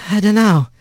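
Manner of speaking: dazed rat. A narrator's reading.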